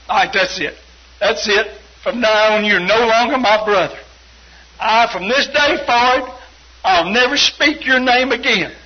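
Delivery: loud, emphatic mock ultimatum; angry disowning. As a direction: pronounce the break like a furious brother. Retold brother disowning speaker.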